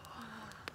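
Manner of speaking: long quiet gasp; huge breath in. A narrator's reading. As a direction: suck in air like a dragon. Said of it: "took another huge breath".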